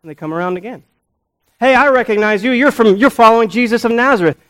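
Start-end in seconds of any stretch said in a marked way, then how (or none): 1.6-4.4 high, loud acted call-out; loud accusation. Bystander recognizing Peter by his accent, loud and accusing. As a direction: call him out excitedly across the room. Accuser comes around again.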